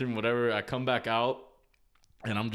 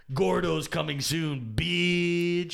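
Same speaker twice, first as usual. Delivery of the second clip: higher, louder, slow hype; hyped shout; 7 semitones higher, 3 dB louder, much slower than usual. Real, not acted. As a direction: announce it like a hype man. Hyped announcement.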